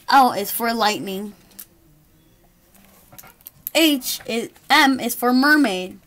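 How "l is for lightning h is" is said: plain reading voice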